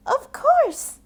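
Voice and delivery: very high, loud welcome; eager yes. Acted. Cassie welcoming the farmers, high and loud. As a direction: squeal it gladly. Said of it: "Cassie replied".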